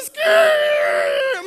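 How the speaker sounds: high cracked shout of disbelief; shocked yelp. Retold or imitated. Son stunned that his father said I love you, yelps. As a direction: yelp it as if the ground dropped away.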